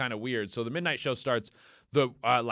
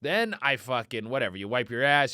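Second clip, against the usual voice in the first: ordinary talk, nothing marked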